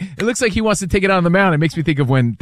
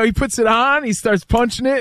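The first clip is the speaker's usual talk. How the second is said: ordinary delivery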